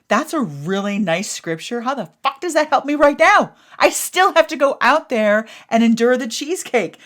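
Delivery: high, loud indignant retort; mad reply. Retold or imitated. Texting him back, high loud indignant retort. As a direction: throw his scripture back at him. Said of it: "I was so mad at him".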